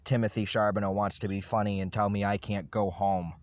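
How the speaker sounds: ordinary talk, nothing marked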